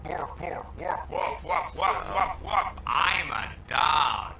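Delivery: dog barking words, hushed then loud; talking dog voice. Acted. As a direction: play a deranged talking dog. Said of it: "It says".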